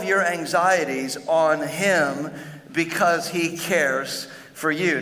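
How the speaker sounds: slow, deliberate scripture reading. Acted. Pastor reads the verse slowly to the congregation. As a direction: let each phrase of the verse land slowly.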